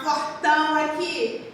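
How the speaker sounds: normal talking voice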